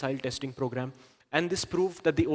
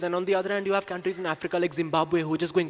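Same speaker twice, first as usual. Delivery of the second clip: ordinary delivery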